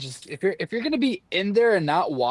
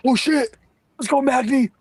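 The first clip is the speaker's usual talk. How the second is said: mock cheer, pitched higher; imitating Justin yelling; 10 semitones higher, 4 dB louder than usual. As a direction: play the friend spoiling the fight with a yell. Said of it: "Just yelling".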